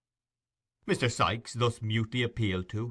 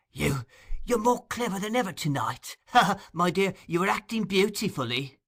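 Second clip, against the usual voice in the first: high, sly, laughing flattery; butter her up with a mocking chuckle; wheedling old fence; 9 semitones higher than usual.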